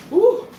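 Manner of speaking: sharp high yelp from spice heat; high pained ooh. Real, not acted. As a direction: yelp as the pepper burns. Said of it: "Man, that's hot".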